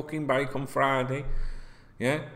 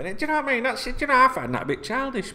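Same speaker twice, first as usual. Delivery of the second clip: ordinary talk, nothing marked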